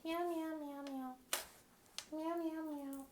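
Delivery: high, playful cat meows; playful meowing. Pretend. Vlogger meowing at a teal cat brooch, playful. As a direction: meow like a kitten, up high.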